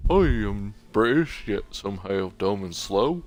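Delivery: slow, dim-witted British accent, mocking; dumb British accent. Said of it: "It's such a dumb accent".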